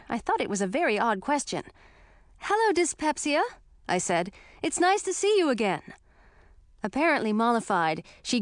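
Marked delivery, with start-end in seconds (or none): 2.4-3.4 put-on young princess voice. 4.6-5.9 put-on young princess voice.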